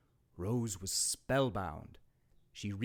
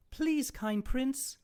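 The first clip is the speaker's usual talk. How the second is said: high, slow pleading fairy voice; fairy godmother; slower than usual. Acted. Fairy godmother begging the prince, high pleading. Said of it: "said one".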